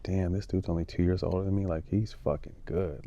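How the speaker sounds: a retold awed mutter under the breath. Watching Kobe train as a college kid, hushed awed mutter.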